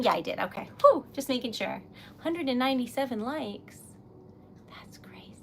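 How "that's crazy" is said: breathless whisper. Real, not acted. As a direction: whisper it in awe. Stunned whisper.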